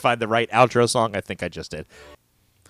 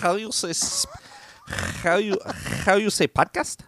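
higher fake Spanish accent on How you say podcast; mock Spanish accent; 6 semitones higher, 5 dB quieter than usual. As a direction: ham up the phony Spaniard. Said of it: "thick Spanish accent".

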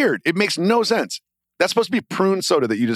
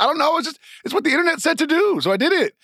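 defensive; 11 semitones higher, faster than usual. Retold or imitated. Retelling his defence to his wife.

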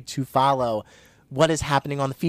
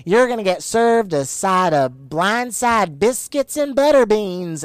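imitating a put-on Southern drawl, higher, louder, slow; slow, higher, louder put-on Southern accent; mock fake Southern accent; 7 semitones higher, 5 dB louder than usual. Podcaster mocking a reality star's fake Southern accent. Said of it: "fake Southern accent that she really puts on".

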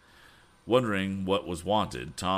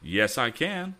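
higher-pitched, confident cry; insistent cry; 8 semitones higher, 4 dB louder, slower than usual. Acted. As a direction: cry it back with determination. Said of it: "cried Tom".

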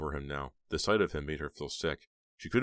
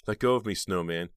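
loud demand; 4 semitones higher, 7 dB louder than usual. A narrator's reading. Heather struggling in his grip.

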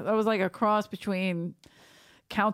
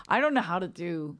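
normal talking voice

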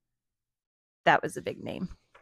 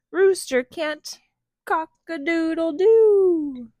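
high, loud, drawn-out cry; animal announcement; 8 semitones higher, 8 dB louder, much slower than usual. Acted. Hens and cows alert the sheep, high loud drawn-out call. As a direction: call it out slowly, crowing the last word.

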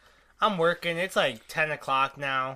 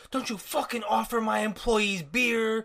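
slow, higher scolding imitation; mock angry boss; 4 semitones higher, slower than usual. Boss calling customers about beer, mock angry. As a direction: draw out the telling-off like an irate boss.

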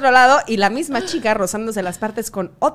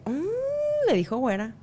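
disapproving mock; 8 semitones higher, 4 dB quieter, slower than usual. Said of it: "un tercero que dice".

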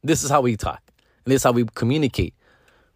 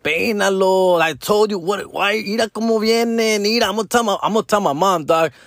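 raised, higher and worked up, squabbling; playing himself bickering with his baby's mama, higher pitched; mock heated argument; 6 semitones higher than usual. Talking to baby's mama in a mock argument, higher and heated.

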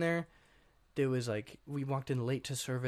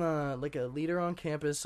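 ordinary talk, nothing marked

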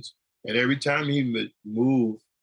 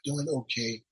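softer, barely voiced reply; retold whisper; 6 dB quieter than usual. Weak patient answering the nurse, soft barely voiced reply. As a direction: answer the nurse with what little voice is left. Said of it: "I can only whisper".

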